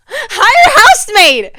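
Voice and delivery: loud, high outburst, drawn out; yelling. Real, not acted. Vlogger snapping at men who expect her housework, yelling. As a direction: yell it out in exasperation. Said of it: "sorry for yelling".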